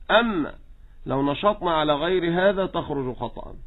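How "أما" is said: ordinary talk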